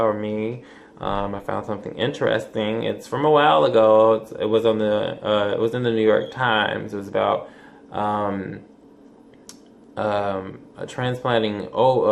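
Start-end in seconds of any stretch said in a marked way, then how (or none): none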